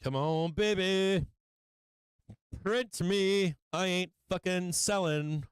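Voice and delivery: high, drawn-out defiant cheering; urging it on. Real, not acted. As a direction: urge it on high and slow, digging in. Urging the trade on, refusing to sell.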